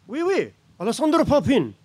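high, loud, hammed-up French "Oui, oui"; mock Frenchman eagerly confirming his name; thick French accent. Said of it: "a very thick French accent".

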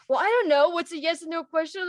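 high, loud mock bewilderment; exasperated, hammed-up confusion; clueless character. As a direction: play him dim and flustered.

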